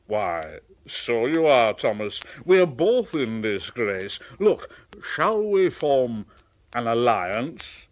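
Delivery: lightly voiced big engine; hearty Gordon. Gordon proposing an alliance to Thomas. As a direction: propose it grandly.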